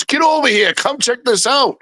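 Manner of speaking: high, urgent mock yell; retold yelling. Richie calling Joe over to look, imitated high yell. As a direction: imitate calling someone over, pitched well up. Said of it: "and then he's yelling".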